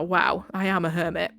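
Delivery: ordinary delivery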